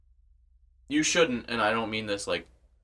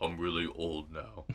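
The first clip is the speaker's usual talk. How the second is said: mock-deep, softer low voice; really low voice; 4 semitones lower, 8 dB quieter than usual. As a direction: play a kid faking a grown-up low voice. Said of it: "talk really low".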